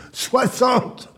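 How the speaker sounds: exasperated tirade building to high cries; angry shouting. Acted. Man berating club owner over cancelled contracts, shouting angrily. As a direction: shout out your despair at being ignored. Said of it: "Arrête de crier!".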